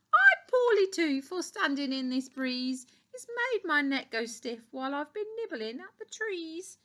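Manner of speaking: high-pitched storybook giraffe; calling-out giraffe. A narrator's reading. Poorly Giraffe complains to the doctor, calling out. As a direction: call out your complaint in a high voice. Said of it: "Giraffe called out".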